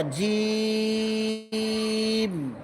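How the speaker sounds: slow chanted letter name জিম; long drawn-out jim. Shown as a demonstration. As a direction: stretch জিম into one long held note. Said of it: "শক্ত করো".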